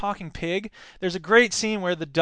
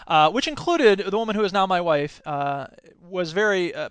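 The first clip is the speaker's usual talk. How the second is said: ordinary delivery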